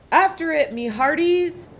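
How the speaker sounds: loud, high urgent order; pirate command. A narrator's reading. Captain orders crew after the ball, loud command. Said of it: "Braidbeard commanded".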